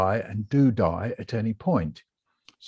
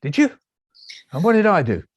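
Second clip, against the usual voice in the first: high, louder astonished questions; acted amazement; 7 semitones higher, 5 dB louder, faster than usual. Patient learning about his forgotten birthday, amazed. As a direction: react in comic disbelief.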